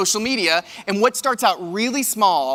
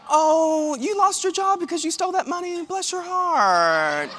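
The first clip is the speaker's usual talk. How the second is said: much higher, sugary Southern put-down; fake-sweet voice that hides an insult, bless your heart stretched slowly; mock sweet Southern lady; 9 semitones higher, slower than usual. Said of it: "It's like".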